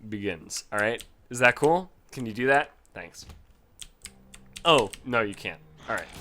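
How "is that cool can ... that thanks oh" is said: ordinary talk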